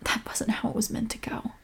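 soft, near-whispered regret; hushed and deflated; regretful self-talk. Acted. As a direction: murmur it to yourself after a row. Alone after the quarrel, regretful.

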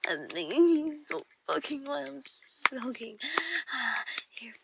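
sultry erotic whisper; seductive whisper. Acted. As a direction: whisper it to a lover in the dark.

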